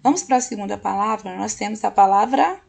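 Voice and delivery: usual speaking voice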